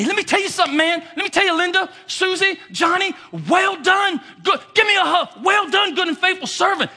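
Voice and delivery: Jesus shouting praise, loud and high; joyful shouting. Retold or imitated. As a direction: call out the praise with overflowing joy. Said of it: "Jesus is gonna say".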